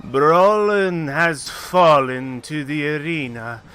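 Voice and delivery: loud, slow ringing proclamation; arena announcer. Acted. Smiling arena mistress rules against Vlad, grandly drawn out. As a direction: draw out each phrase for the whole arena.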